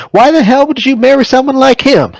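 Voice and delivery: sudden high, loud burst of disbelief; exasperated outburst. Acted. Son blurts at his mother about her husband, exasperated.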